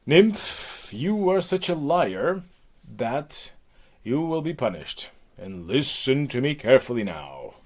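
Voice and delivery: theatrical commanding decree; stern goddess Hera. Acted. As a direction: hand down a punishment like a goddess. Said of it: "Hera decided to punish Echo".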